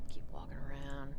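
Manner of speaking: whisper. Real, not acted. Whispered aside.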